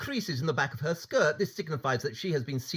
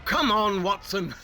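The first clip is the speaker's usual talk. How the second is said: urging call; 5 semitones higher, 4 dB louder than usual. Acted. Holmes hurrying Watson along.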